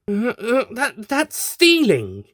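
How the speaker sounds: high, slow, stammered outrage; indignant, stammering protest; cross shout. Acted. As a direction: splutter it out, furious. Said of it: "the doctor shouted".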